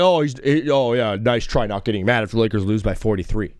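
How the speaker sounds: lightly voiced doubting fan, smug; mocking listener voice. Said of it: "you're like".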